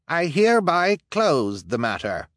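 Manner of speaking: high formal pronouncement; pompous elder. Acted. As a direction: declare it closed. Elder closes.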